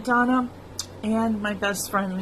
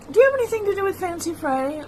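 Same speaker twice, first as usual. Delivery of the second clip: higher, fast, lightly voiced old father; quick, high mock of a confused dad; imitating her dad; 9 semitones higher, much faster than usual. Daughter quoting her forgetful dad, light mimicry. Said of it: "Sometimes he would say".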